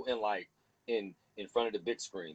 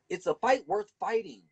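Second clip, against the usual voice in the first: ordinary talk, nothing marked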